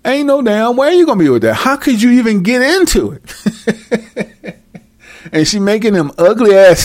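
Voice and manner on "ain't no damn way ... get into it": ordinary talk, nothing marked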